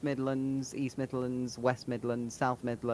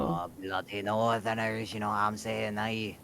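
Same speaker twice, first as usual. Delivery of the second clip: mock British grumble; 7 semitones lower than usual. Joking about northerners, low mock British grumble. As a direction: grumble like a cranky old Brit.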